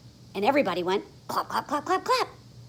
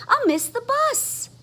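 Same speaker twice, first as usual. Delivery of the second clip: panicky; 4 dB louder than usual. Acted. Froggy panics about bus.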